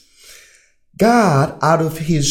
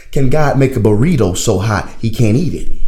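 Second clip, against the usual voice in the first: ordinary delivery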